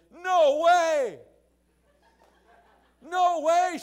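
high, loud amazed outburst; excited exclamation. Retold or imitated. Sister stunned that the preacher came to her door, excited outcry. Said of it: "And his sister Tiffany said".

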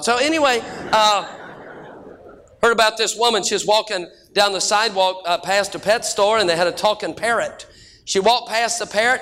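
ordinary talk

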